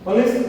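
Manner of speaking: पुलिस with a short light first vowel, like pə-lis; clear pronunciation demo. Shown as a demonstration. Teacher models पुलिस for students, first vowel short and light. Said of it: "ध्यान से सुनिए".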